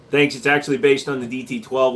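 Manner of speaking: ordinary talk, nothing marked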